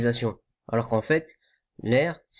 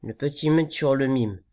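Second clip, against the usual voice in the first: ordinary talk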